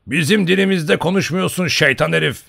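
loud, high scolding; boisterous bellowed complaint; shouted rebuke. A narrator's reading. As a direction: berate the servant noisily. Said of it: "diye bağırdı ona".

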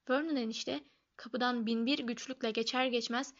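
ordinary delivery, nothing marked

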